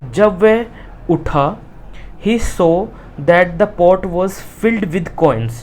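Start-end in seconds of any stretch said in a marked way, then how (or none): 2.3-5.5 slow, word-by-word reading; slow reading aloud. Shown as a demonstration. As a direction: dictate it to the class. Teacher reads textbook line. (धीरे-धीरे पढ़ना)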